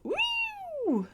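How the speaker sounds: very high woo whoop. Real, not acted. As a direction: whoop of delight while flying. Whizzing through the air.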